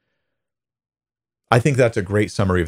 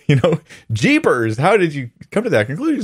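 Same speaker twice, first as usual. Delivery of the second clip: mock astonishment, pitched up; hammed-up disbelief at a silly conclusion; incredulous refutation; 8 semitones higher than usual. Paraphrasing the verse as a rebuke, incredulous. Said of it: "sounds like it's saying".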